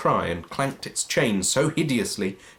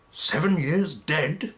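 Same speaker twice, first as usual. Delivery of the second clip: musing; 6 semitones higher, slower than usual. Acted. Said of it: "mused Scrooge".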